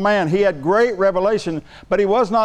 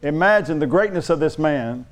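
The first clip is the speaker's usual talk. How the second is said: ordinary delivery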